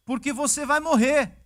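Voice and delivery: booming prophet's verdict; loud stern prophecy. Retold or imitated. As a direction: deliver the death sentence with force. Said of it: "O que que continua falando".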